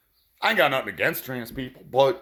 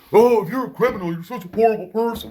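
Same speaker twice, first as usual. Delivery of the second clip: high, loud sneering mimicry of a pearl-clutcher; mocking the morons; 10 semitones higher, 4 dB louder than usual. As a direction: parrot them in a shocked, high, loud put-on voice. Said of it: "you try telling these fucking morons that".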